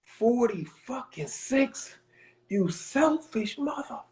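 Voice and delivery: slow, disbelieving coach outburst; imitating angry coach. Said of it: "He looks at me and goes".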